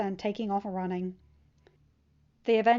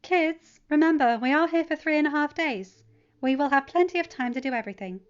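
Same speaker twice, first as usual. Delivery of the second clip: higher, louder, gently firm; motherly Pepper; 6 semitones higher, 7 dB louder than usual. A narrator's reading. Pepper reminds the excited kids, gently firm mom. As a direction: settle the kids down.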